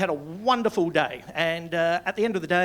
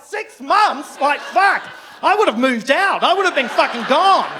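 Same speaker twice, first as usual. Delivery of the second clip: much higher, louder mock outrage; incredulous outburst; 8 semitones higher, 9 dB louder than usual. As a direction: shout the disbelief, half laughing. Retold disbelief at tantrum length.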